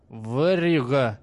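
put-on slow, loud drunken yell. A narrator's reading. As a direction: bellow it drunkenly. Drunk smith yells thief.

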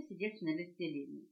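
ordinary delivery

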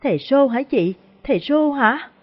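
high, tearful questioning; whimpering. A narrator's reading. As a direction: ask it like someone about to cry, pitch climbing. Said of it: "Hoa nhìn chị mếu máo". (mếu máo hỏi, giọng cao)

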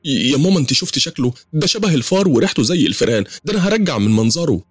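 high-pitched complaint to her mother; acted daughterly whine of disgust; disgusted protest. A narrator's reading.

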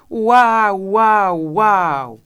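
very loud, drawn-out chanted howls, falling; chorus wail. A narrator's reading. Cook and baby joining the chorus, howling loudly. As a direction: howl it like a wailing chorus. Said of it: "Coro, en el cual tomaba parte".

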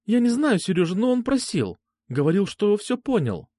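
high-pitched, defensive woman's voice; guilty Alfa. Acted. Alfa explains letting him in, guiltily defensive.

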